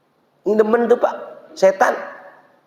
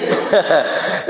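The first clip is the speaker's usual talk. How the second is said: short airy chuckle; amused laugh; 5 semitones lower than usual. Real, not acted. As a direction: chuckle at the contradiction. Laughs at the conflicting answers. (tawa kecil geli)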